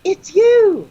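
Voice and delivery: high, loud mock shriek of recognition; imitated high-pitched outburst; astonished exclamation. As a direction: cry out in comic shock, voice leaping up. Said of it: "she goes".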